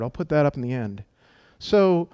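normal talking voice

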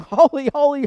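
loud, high ringing cry of Holy; angels crying holy. Acted. Seraphim crying to each other before the throne, loud and ringing. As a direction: call it out like the seraphim. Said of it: "they're saying".

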